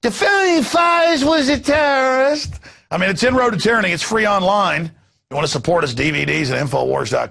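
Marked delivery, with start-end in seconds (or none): 0.0-2.5 high, slow, hammed-up country boy accent; put-on country boy lecturer, pitched way up. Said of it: "I'm doing a country boy accent".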